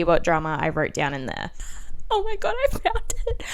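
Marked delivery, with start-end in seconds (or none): 2.1-3.4 high, thrilled squeal of discovery; excited find. Real, not acted. Finding her teenage diary while filming, excited high voice. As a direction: gasp it out, delighted to find it.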